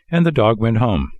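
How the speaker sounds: ordinary delivery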